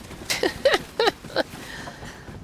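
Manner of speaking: soft airy laugh under the breath; a quick amused giggle to herself; breathy giggle. Real, not acted. Giggling at her own chatter while playing.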